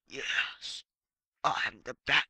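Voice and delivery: low, rasping whispered growl; husky gravel-throated caped crusader; Batman voice. Acted. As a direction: play it like a brooding masked hero. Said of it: "when I do this voice".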